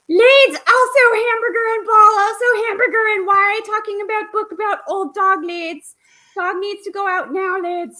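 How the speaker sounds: very high, loud, cartoonish puppy voice; a hyper young dog demanding hamburger; [name]'s dog voice. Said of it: "Mickey Mouse on steroids".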